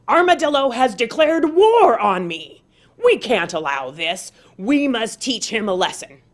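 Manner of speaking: loud, forceful rallying cry, stomp slowing; rallying war speech. Acted. Tiger rallying the four-footed animals against Armadillo, loud and forceful.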